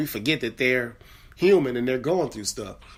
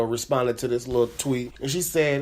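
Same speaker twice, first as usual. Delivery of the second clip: ordinary delivery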